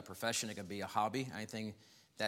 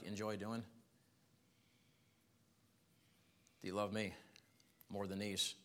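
tender question; 7 dB quieter than usual. Acted. Jesus asks Peter about love.

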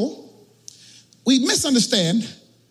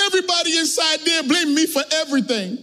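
higher-pitched mock-tearful grievance; crying devil; 4 semitones higher, faster than usual. Satan explains his tears to God, mock crying. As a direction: play the wronged devil in a put-on wail. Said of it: "and he's crying".

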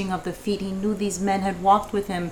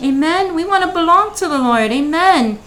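higher, louder preacher's call; preaching at full force; 7 semitones higher, 7 dB louder than usual. Acted. Host stirring up the listeners, preaching higher and louder. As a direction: rouse the room like a preacher. Preaching climax after prayer.

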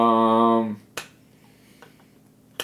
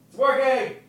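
high, distant call; calling from afar; 10 semitones higher than usual. Shown as a demonstration. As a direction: call it back from the next room. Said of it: "go to the kitchen and yell".

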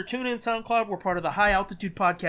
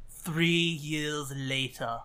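documentary narrator; 5 semitones lower, 3 dB quieter, much slower than usual. Pretend. Jokey title card.

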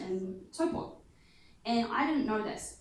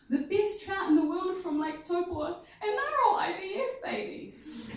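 tearful friend; 10 semitones higher, 6 dB louder than usual. Retold or imitated. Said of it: "she just burst into tears and she's like".

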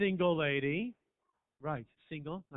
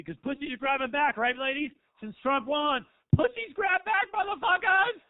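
much higher and louder, a rallying cry; mock rally shout; 11 semitones higher, 13 dB louder than usual. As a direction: yell it like a protest chant.

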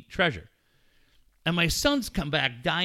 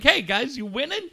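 cheery mock greeting; 10 semitones higher than usual. Mock friendly fan greeting.

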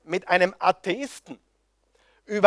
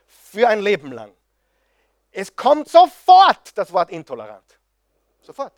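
much higher and louder heated sermon; fervent preaching; 5 semitones higher, 6 dB louder than usual. Real, not acted. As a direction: preach it out at full force. Preacher at full force.

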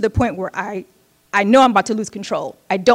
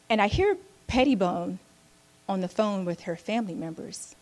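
ordinary talk

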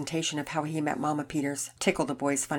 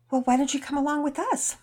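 voiced mother; 9 semitones higher than usual. Mama Peters voiced in story.

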